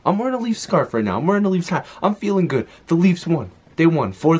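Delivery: can barely contain the happiness; giddy excitement. Real, not acted. Repeating the line, climbing excitement.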